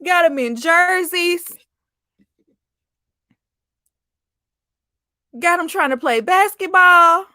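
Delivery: high, drawn-up exclaiming; high-pitched disbelief. Real, not acted. Mocking the baby's jerseys to viewers, high-pitched disbelief. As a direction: push the voice up high in mock dismay. Still squealing over the child's look.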